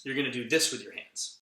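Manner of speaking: plain reading voice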